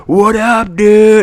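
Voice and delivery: loud, high, drawn-out holler; imitating a buddy's whooping hello; rowdy party greeting. Acts beer-holding friend's greeting.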